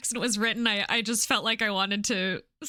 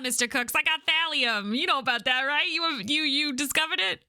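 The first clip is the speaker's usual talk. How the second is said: lightly voiced pushy talker, higher pitch; eager game character; 5 semitones higher than usual. Offering thallium to Mister Cooks, lightly acted eager pitch. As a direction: lightly act an insistent seller, pitched up.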